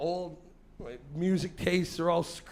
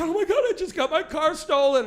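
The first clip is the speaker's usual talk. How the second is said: higher, louder frantic wail; upset woman; 10 semitones higher, 7 dB louder than usual. Retold or imitated. Woman on the curb tells the comic about her car, frantic high wail. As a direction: panic about the stolen car. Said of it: "She said".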